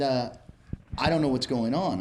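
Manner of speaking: ordinary talk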